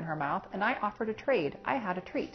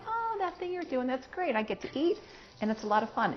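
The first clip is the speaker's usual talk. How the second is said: lightly voiced, higher eager dog inner voice; dog's happy thoughts; 7 semitones higher than usual. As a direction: voice the dog's delight a touch higher. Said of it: "they're associating".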